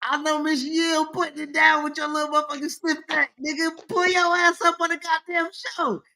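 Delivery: high-pitched mock scolding; mock call-out. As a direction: call him out like a heel promo. Calling out absent co-host.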